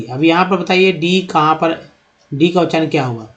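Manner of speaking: ordinary talk, nothing marked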